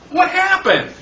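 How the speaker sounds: high, baffled "what happened"; bewildered. Acted. Canoeist left in a dry river, bewildered and high. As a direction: play the stranded man who can't believe it.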